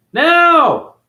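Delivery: loud high yell; sudden shout. Real, not acted. Ranting vlogger shouts at the viewers. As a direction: bark it out at the haters.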